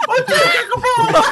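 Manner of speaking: laughing mock shout.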